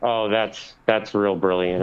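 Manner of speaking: low, dry sarcasm; deadpan the compliment; sarcastic. Real, not acted. Sarcastic reply in argument.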